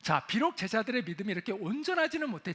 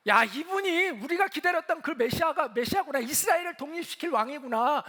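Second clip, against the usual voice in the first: higher, louder voice of an acclaiming crowd; acted-out excitement, pitched up and louder; excited crowd; 5 semitones higher, 5 dB louder than usual. Crowd hails Jesus as the awaited Messiah, excited.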